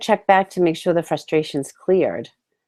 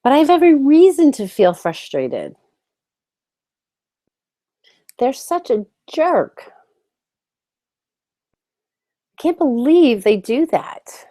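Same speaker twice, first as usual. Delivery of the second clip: acted frustration; 8 semitones higher than usual. Coach voicing the viewer's anger at a jerk, heated and raised. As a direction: let the frustration burst out, pitched up. Voices frustration in tapping script.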